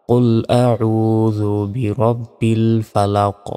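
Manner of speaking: slow, drawn-out melodic chant; tajweed recitation, stretched vowels; Quran recitation. Shown as a demonstration. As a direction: chant each word long and ringing. Recited verse model.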